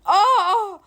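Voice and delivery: mock startled oh. Imitates friends standing up dizzy.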